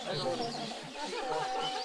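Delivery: put-on sulking child. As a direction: turn away and sulk like a small boy.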